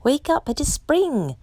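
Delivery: high, mild shout of joy; mild storybook shout. A narrator's reading. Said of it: "shouted Frog".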